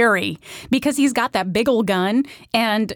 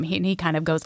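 usual speaking voice

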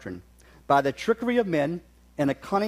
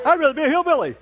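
defiant retort; 9 semitones higher, 5 dB louder, faster than usual. Retold or imitated. Mock-indignant retort in a story.

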